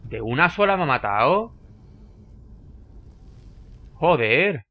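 loud, incredulous outburst; real exclamation of startled dismay; stunned disbelief. Real, not acted. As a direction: react in shock to an instant defeat. Killed by a single hit.

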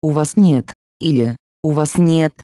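ordinary delivery

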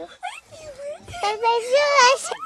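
very high, slow sing-song; exaggerated cartoon intonation. Shown as a demonstration. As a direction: stretch it out in a cartoon voice. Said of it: "Yes, I do".

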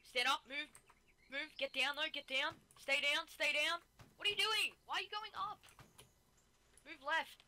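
real alarm, pitch rising sharply; urgent orders. Real, not acted. Commander correcting team mid-fight.

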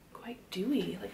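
ordinary talk

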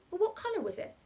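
put-on children suddenly keen; eager question. A narrator's reading. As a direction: ask with sudden keen interest. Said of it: "amid an immediate quickening of interest".

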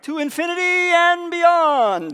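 booming, drawn-out superhero cry; high, loud heroic catchphrase, stretched out; Buzz Lightyear. Acted. Preacher quoting a cartoon hero to the congregation, booming impression. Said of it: "like, Buzz Lightyear".

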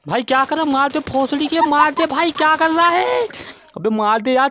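high-pitched, climbing exasperated plea; exasperated urging. Real, not acted. Player begs teammate to kill the enemy, exasperated urging. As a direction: urge him on, pitch rising with each phrase.

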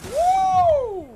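long high loud "woo"; sustained high-pitched whoop. Real, not acted. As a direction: let out a high, held whoop. Startled whoop in shooter game.

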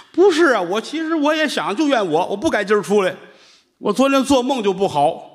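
high, plaintive excuse; whiny seller. Acted. Vegetable seller moaning to the nuns about his bad dream, high and plaintive.

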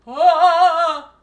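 loud, high "Ah!"; a long, sudden high yell; loud scream. Real, not acted. Startled on seeing double camera.

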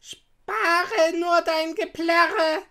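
high, loud, drawn-out witch voice; old witch. A narrator's reading. Witch silencing weeping Gretel, scolding. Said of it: "sagte die Alte".

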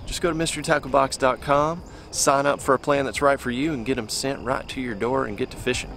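ordinary delivery

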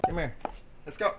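ordinary delivery

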